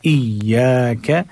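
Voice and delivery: slow इय्याक leaning on the double y; doubled ya with force. Shown as a demonstration. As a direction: press and hold the य्य in इय्याक. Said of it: "या मुशद्दद है जोर के साथ". (इय्याक में या पर ज़ोर)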